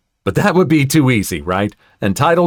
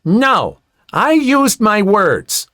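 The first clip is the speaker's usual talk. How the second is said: higher, drawn-out child's protest, lightly voiced; audible toddler protest; 9 semitones higher, slower than usual. A narrator's reading. Toddler to woman grabbing his sled, audible protest. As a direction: say it up high like an insistent small son. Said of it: "my son very audibly says".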